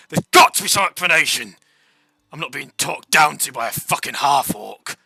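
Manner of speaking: loud indignant captain snapping back; angry retort. Acted. Guard captain to the half-orc, loud angry retort. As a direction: bark it back, offended and loud. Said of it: "the guard captain retorts".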